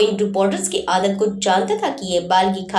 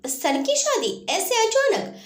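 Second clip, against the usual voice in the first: high, slow, astonished; amazed question; 12 semitones higher, slower than usual. A narrator's reading. Constables stunned by the sudden wedding news, astonished. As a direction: gasp it out in disbelief. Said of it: "सिपाहियों को हैरत हुई".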